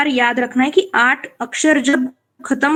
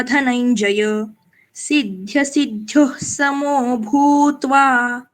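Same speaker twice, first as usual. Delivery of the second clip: slow, measured four-line verse; slow shloka recital; slower than usual. Shown as a demonstration. Teacher recites the next shloka slowly. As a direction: chant it slowly with pauses. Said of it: "आगे देखिए".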